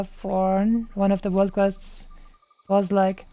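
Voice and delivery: ordinary talk, nothing marked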